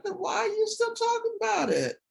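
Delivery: high, scolding church lady voice; grandmother's gentle scolding. Retold or imitated. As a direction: tease the child with loving exasperation. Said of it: "She said".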